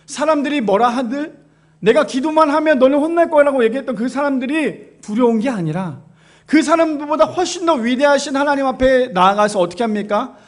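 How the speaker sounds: normal talking voice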